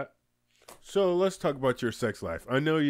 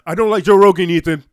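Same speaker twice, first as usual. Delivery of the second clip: higher, much louder blurted declaration; abrupt raised-voice announcement; sudden loud outburst; 5 semitones higher, 14 dB louder than usual. Pretend. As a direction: call it out loudly, as if to Ethan. Sudden outburst at an absent podcaster.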